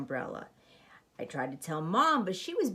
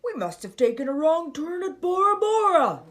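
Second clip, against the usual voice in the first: higher, louder pirate bluster; pirate captain; 9 semitones higher, 7 dB louder than usual. Acted. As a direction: bluff pirate puzzling aloud. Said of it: "the pirate said".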